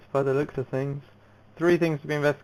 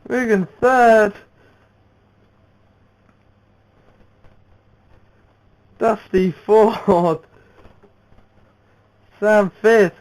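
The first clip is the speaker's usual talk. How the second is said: loud, raised race call at the finish line; excited race commentary; 6 semitones higher, 7 dB louder than usual. Real, not acted.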